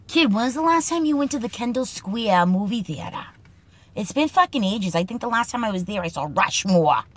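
put-on a Boston guy asking about the Kendall Square movie theater, Boston accent. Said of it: "Boston Speak segment, Kid lines".

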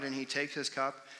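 usual speaking voice

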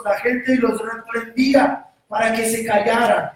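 loud, high-pitched preaching read; fervent preaching. Acted. Pastor reading Scripture at full preaching force. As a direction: proclaim it to the congregation. Preaching the blind men story.

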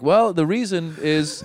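slow, strained patient explaining; the doctor in me. Retold or imitated. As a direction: explain slowly, keeping the professional front. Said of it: "the doctor in me going like".

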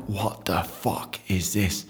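low, soft, slow stunned mutter; stunned hushed disbelief. Retold or imitated. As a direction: drop low in shock. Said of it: "I'm like".

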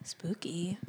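hushed, unvoiced spooky whisper. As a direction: whisper it like a ghost story.

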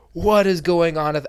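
slow loud bewildered cry; alarmed what. Retold or imitated. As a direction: demand an explanation in alarm. Shocked at message.

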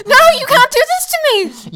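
high, loud, indignant protest; mock outrage. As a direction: play up the betrayal, loud and high.

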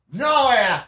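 loud, high-pitched oddball; hammed-up stranger voice; imitated eager stranger. Stranger's answer acted out.

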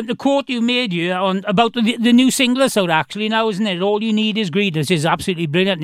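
ordinary talk

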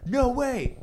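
a loud, high protest at the screen; alarmed. Retold or imitated. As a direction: yell it at the screen. Reacting to scary movie scene.

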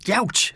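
high pained squawk. A narrator's reading. Howard jabbed, squawking in pain. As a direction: yelp out in hurt. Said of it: "squawked Howard".

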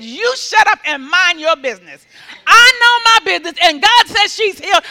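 loud, rising acted quarrel; angry retort. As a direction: shout the heckler down. Healer yells back at heckler.

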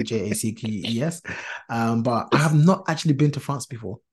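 ordinary delivery

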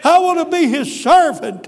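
loud, high cry of devotion; high, full-voiced retold declaration; exultant shout. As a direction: shout it out as the joyful believer. Joyful man's fervent answer, acted out.

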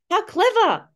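very high marvelling voice; mock amazement. Mum praising the child who beat her rule, high mock wonder. Mum retelling being outwitted.

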